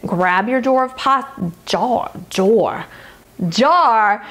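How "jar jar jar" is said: jar stretched in a drawled Louisiana accent; southern Louisiana twang on jar. Real, not acted. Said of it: "Y'all notice that accent that just came out there".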